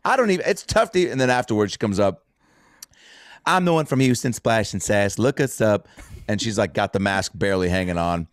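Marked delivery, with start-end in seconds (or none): 0.0-1.1 very high, flustered Houston twang; thick Houston accent. Retold or imitated. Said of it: "someone with a thick accent like that". 3.5-5.9 lightly imitated Houston twang; thick Houston accent. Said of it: "someone with a thick accent like that".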